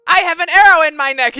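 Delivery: high, loud mocking echo; mocking whine. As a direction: parrot it back mockingly. DM mocks player's complaint.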